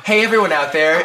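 a hint of peppy children's presenter; Dora the Explorer. Host greeting an imagined audience, light Dora parody. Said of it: "It's like Dora the Explorer".

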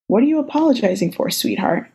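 ordinary delivery

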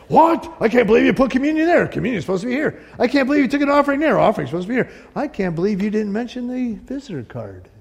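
high, fast, indignant complaining; outraged churchgoer. Pretend. Church member complaining about service order, high fast indignation. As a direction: splutter like a fussy member upset at change.

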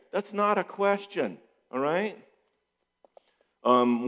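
ordinary talk, nothing marked

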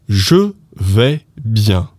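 very slow "Je vais bien", word by word; spaced-out textbook "Je vais bien"; careful full form. Shown as a demonstration. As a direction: sound "Je" fully, as "zhuh". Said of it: "instead of that".

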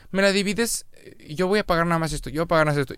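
mock high-pitched customer; mimicked young customers. Voices kids splitting the bill.

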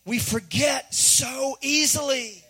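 "forget so easily" stretched slowly, word by word; slow, deliberate emphasis on "so easily"; important-point emphasis. Shown as a demonstration. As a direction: drive home "so easily" with slow, weighty stress. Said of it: "that's an important point".

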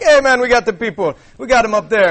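high, loud lemur king; King Julian. Acted. Lemur king answering the lion, high and loud. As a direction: pitch it up, announcing it brashly. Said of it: "And Julian's like".

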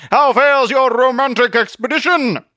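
high, loud, pompous inquiry; blustering Zealot, jovial. Acted. Zealot asks about Truth's date, jovial bluster.

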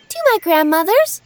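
put-on high girl voice; little girl voice. A narrator's reading. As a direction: answer as a small girl. Girl answering the wolf.

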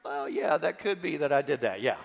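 low, soft, reluctant owning up; sheepish admission. Retold or imitated. First grader admits leaning back to the principal, sheepish and subdued. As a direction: imitate a boy meekly conceding the point.

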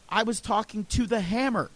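ordinary talk, nothing marked

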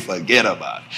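very low, soft gangster delivery; mobster. Retold or imitated. Friend answers the visitor like a gangster, very low and soft. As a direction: drop it deep and quiet like a mob boss. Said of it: "You think you a gangster".

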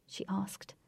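plain reading voice

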